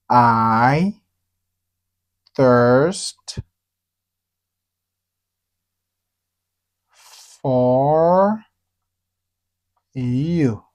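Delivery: "I... thirst... for... you" very slow, long pauses between words; word-by-word demonstration. Shown as a demonstration. Teacher modelling a phrase for learners, drawn-out word by word. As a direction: stretch each word of "I thirst for you" separately.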